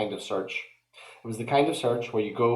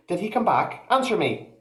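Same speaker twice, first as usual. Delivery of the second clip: much higher, pressing, frightened mother; urgent demand; 9 semitones higher than usual. Acted. Mother hunting for her toddler, frantic demand. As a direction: demand an answer in panic. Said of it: "asked urgently".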